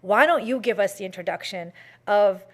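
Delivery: ordinary delivery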